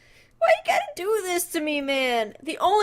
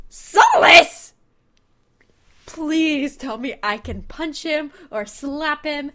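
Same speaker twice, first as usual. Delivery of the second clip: ordinary talk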